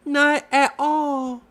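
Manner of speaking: high little Piglet voice, stammering; timid Piglet. Acted. Piglet answers Roo, high and shy.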